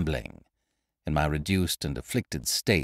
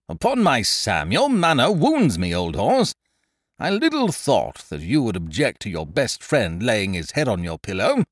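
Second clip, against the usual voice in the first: loud, higher, hammed-up hurt; wounded Ukridge; 4 semitones higher, 6 dB louder than usual. A narrator's reading. Ukridge protesting to his friend, mock-wounded. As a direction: play the injured friend grandly.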